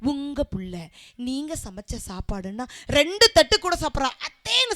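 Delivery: lightly hushed, husky coaxing; a hint of a breathy sweet-talker. Shown as a demonstration. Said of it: "அதே husky வாய்ஸ்ல". (லேசான husky குரலில் கொஞ்சல்)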